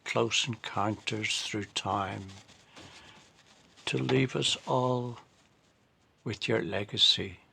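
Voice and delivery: slow, measured poetry recital; poem reading. Acted. Poet reading a tribute to two late friends, slow measured recital. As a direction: read it line by line, drawing out the phrases, with pauses. Reads own memorial poem.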